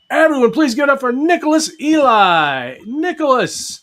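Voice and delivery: loud, high emcee introduction; announcer voice. Acted. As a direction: give it up like a host. Announcing the guest.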